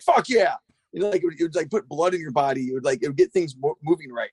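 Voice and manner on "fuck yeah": hyped. Retold or imitated. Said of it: "You'd be like".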